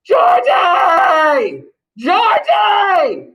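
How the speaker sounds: loud, high, drawn-out shout; yelling for dog. Retold or imitated. Teacher imitating a man looking for his dog, yelling. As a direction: holler the name as if searching through dark woods.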